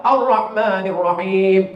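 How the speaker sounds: Ar-Rahmanir Rahim chanted slowly; slow recitation. Shown as a demonstration. Preacher reciting for the congregation, slow. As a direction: draw the verse out. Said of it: "Dua banyak kesalahan".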